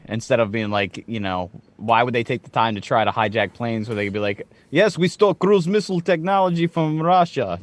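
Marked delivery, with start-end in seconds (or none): 4.7-7.6 a light mock Russian accent, slightly higher. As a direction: play a terrorist in a hammed-up Russian accent. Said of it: "they all speak with Russian accents".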